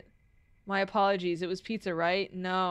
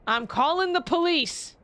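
loud, slow, high mock threat; mock shout; 8 semitones higher, 4 dB louder than usual.

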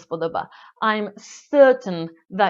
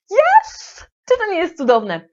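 a much higher, louder burst of joy; excited cheer; 9 semitones higher, 6 dB louder than usual. Real, not acted. Teacher cheering about three words for one. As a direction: shout it out in delight.

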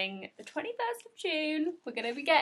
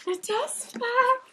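much higher, slow and drawn out; delighted; 12 semitones higher, 3 dB louder, slower than usual. Real, not acted. Seeing the dust bag, high slow delight. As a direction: savour it with delight.

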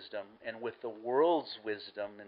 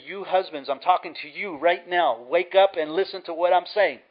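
raised, louder preacher's wake-up call; insistent, building to a loud higher finish; emphatic exhortation; 5 semitones higher, 8 dB louder than usual. Acted. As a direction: drive it home like an urgent command. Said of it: "That's the emphasis".

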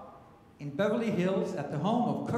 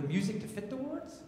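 usual speaking voice

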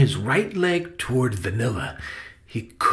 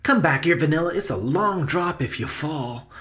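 higher-pitched urgent call to a pet; anxious warning; 6 semitones higher than usual. Acted. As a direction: call the pet back from danger. Benny warns his straying mouse.

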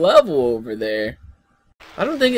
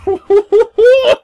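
loud, high laugh; real high laughing burst; burst of laughter; 10 semitones higher, 5 dB louder than usual. Real, not acted. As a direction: crack up in rising hoots. Laughing at the game.

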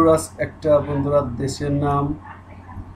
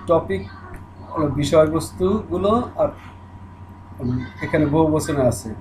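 normal talking voice

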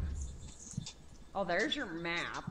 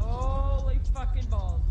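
loud, slow, higher shocked cry; startled exclamation; 7 semitones higher, 6 dB louder than usual. Real, not acted. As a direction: blurt it out in real shock. Jump scare in horror game.

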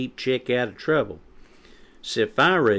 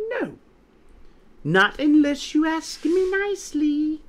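very high, prissy refusal; snide; 4 dB louder than usual. A narrator's reading. Quinny making Chick ask nicely, snide and high. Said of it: "snidely replied".

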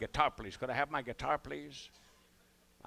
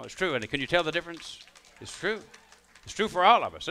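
mock preacher; 4 semitones higher, 7 dB louder than usual. Preaching about the hat, rising.

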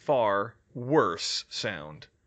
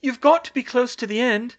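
high, loud, urgent plea; pleading at a high pitch; desperate urging; 11 semitones higher, 8 dB louder than usual. A narrator's reading. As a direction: urge him on, pitched way up. Urging Harry on through the potion.

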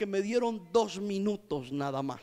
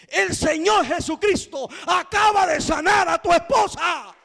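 high, loud good news shouted out; joyful announcement; 6 semitones higher, 6 dB louder than usual. Retold or imitated. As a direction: proclaim the miracle at full voice. Announcing the healing to the husband.

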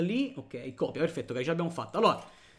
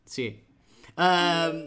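normal talking voice

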